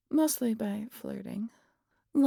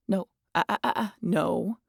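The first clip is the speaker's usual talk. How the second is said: ordinary talk, nothing marked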